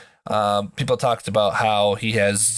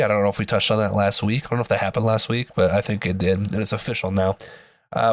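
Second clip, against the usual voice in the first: ordinary talk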